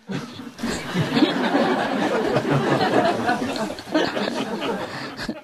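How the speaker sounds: soft airy laugh at the punchline; breathy laugh. Real, not acted. Teller laughing at own punchline, soft airy laugh.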